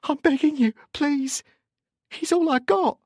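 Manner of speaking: very high, begging wail; desperate. A narrator's reading. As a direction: beg for your only son. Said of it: "tried desperately".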